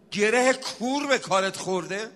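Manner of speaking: rhetorical question in full preaching voice; rousing preacher's question. Real, not acted. Preacher asking troubled listeners about their problems, rousing appeal. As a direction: put it to the crowd, projected.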